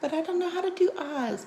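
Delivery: high, fake-whiny viewer complaint. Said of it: "I would hear".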